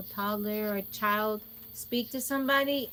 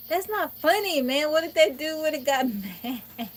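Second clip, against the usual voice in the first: higher, louder half-laughing scold; mock scolding; 6 semitones higher, 6 dB louder than usual. Daughter scolding her laughing mama about the stranger, loud and high. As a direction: fuss at her while trying not to laugh.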